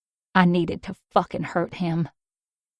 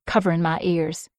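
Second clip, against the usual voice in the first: usual speaking voice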